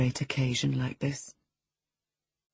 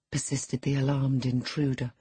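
usual speaking voice

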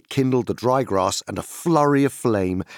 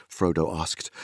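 plain reading voice

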